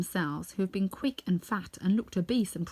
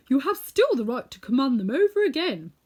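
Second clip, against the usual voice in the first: a hint of the Tin Woodman, louder; lightly voiced Tin Woodman; 5 semitones higher, 8 dB louder than usual. A narrator's reading. Tin Woodman reminds Dorothy of the cap: louder, firm. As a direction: reassure her firmly. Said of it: "counselled the Tin Woodman".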